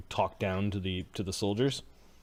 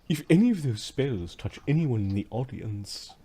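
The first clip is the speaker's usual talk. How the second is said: haughty slave master; 6 semitones higher, slower than usual. Acted.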